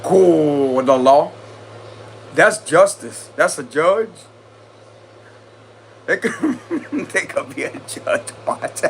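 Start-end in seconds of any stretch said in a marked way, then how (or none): 6.1-8.8 breathy giggles breaking up the line, slower; can't stop giggling mid-sentence; giggling through the words. Real, not acted. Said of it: "how I'm laughing and giggling".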